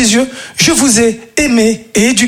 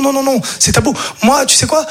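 ordinary talk, nothing marked

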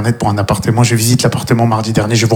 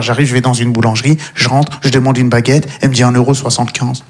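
ordinary delivery

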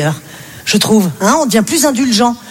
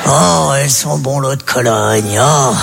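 put-on much lower, slow old-man voice, cooing over his wife; old man doting on Ginette; 6 semitones lower than usual. An old husband sweet-talks his wife Ginette, low and slow. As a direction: croon like a besotted old husband.

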